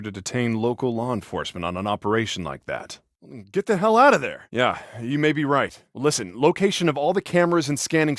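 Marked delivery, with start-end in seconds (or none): 3.5-4.5 high, loud urgent cry; urgent warning. Acted. Brother ordering Delsin out of there, urgent shout. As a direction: yell it to get him moving.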